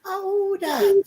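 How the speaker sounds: high, startled who that; frightened call. Retold or imitated. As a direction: call out scared into the dark. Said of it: "and him say".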